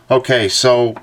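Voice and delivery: ordinary delivery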